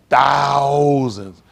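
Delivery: THOUSANDS loud, with a long held vowel; stress on thousands. Real, not acted. Comic contrasts it with two or three, "thousands" loudly drawn out. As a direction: boom out "thousands", stretching the first syllable. Repeats the count for emphasis.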